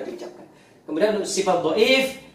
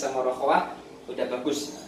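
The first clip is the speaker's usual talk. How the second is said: ordinary delivery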